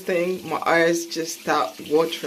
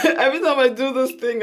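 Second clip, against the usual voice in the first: fast laugh, higher and louder; laughing through tears; 6 semitones higher, 6 dB louder, faster than usual. Real, not acted. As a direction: laugh it off while eyes water. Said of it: "I swear I'm crying".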